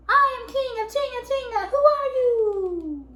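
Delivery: very high, loud echoing call; even bigger voice. A narrator's reading. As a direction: throw it back huge, stretching the question. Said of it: "an even bigger voice".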